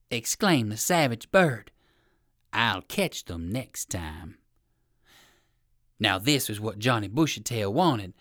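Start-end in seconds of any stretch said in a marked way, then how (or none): 2.5-4.4 low, slow grudge; menacing owl. A narrator's reading. Tricked owl vows to catch them, low menace. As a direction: vow it darkly and deliberately.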